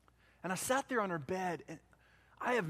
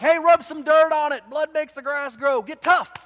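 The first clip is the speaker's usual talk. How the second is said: barked, much louder and higher tough-love yell; gruff football coach; 9 semitones higher, 17 dB louder than usual. Retold or imitated. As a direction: bellow it like a coach who doesn't care. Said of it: "like that eighth grade football coach".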